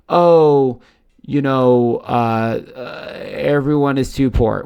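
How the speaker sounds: loud, hammed-up hesitant excuse; mocking doubters. Host voicing naysayers' reasons altcoins won't rise, mocking. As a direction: mimic a feeble objection, loudly.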